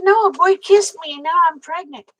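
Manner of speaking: high, loud naive protest; retold indignant protest. Teller re-enacts correcting the preacher about a kiss, indignant. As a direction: insist it loudly, acting out the teenage misunderstanding.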